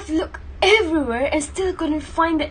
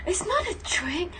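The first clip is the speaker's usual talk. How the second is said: unvoiced, softer hurried whisper; urgent whisper; 3 semitones higher, 5 dB quieter than usual. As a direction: hush them urgently.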